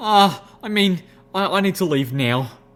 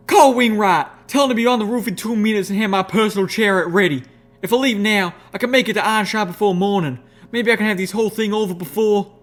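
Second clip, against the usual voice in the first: urgent orders; 9 semitones higher, 6 dB louder than usual. A narrator's reading. Applejack issuing urgent orders.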